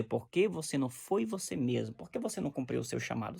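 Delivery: soft, low questioning; voice of God. Pretend. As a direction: ask as God, quietly. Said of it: "ele vai dizer".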